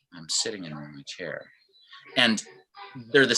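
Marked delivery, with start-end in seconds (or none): 0.1-1.5 much softer, lower and deflated; apathetic, soft mumble. Shown as a demonstration. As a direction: let it sag like you feel down. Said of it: "There's an emotion behind what I'm saying".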